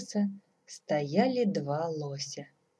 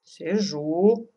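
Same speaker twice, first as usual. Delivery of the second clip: composed, measured reply; sedate; 6 dB louder than usual. A narrator's reading. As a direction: answer sedately, a little loud. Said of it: "степенно отозвался Иван-царевич". (степенно, с достоинством)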